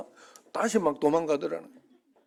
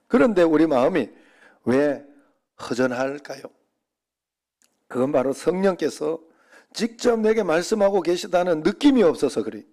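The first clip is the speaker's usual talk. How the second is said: louder, driving sermon delivery; forceful preaching; 5 dB louder than usual. Acted. Pastor urging the congregation, preaching hard and loud. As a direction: press the point home to the congregation.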